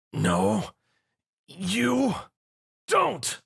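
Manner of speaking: high, straining cry while shoving; strained grunt. A narrator's reading. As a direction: push the beast off with effort. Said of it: "Zio grunted".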